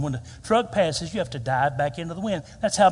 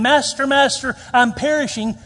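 high, loud panicked plea; crying out; 8 semitones higher, 7 dB louder than usual. Acted. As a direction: mock-desperate cry for help. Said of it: "Don't run out crying".

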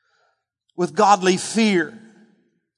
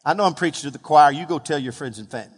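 fast, lower throwaway aside; quick joking aside; 4 semitones lower, faster than usual. Real, not acted. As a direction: toss it off quickly with a grin.

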